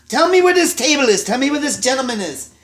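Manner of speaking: loud, high imitated chef demand; angry demand. Chef storming out, demanding the table angrily. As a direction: bark it at the waitress like an enraged chef.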